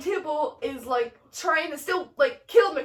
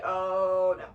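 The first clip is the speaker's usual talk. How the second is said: low, drawn-out oh no; sinking, ominous realization; Romeo's dismay; 7 semitones lower than usual. Acted. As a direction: let the dread sink the pitch right down. Acting out Romeo's dread.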